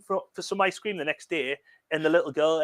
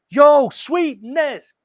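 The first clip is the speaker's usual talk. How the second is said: much higher, louder, slow drawn-out hype shout; group call-out; 9 semitones higher, 10 dB louder, much slower than usual. Retold or imitated. Host retelling the film crew's call to a girl, in a high, loud, drawn-out shout. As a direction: stretch it out like a crew hyping a girl. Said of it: "all of them, uh, together go".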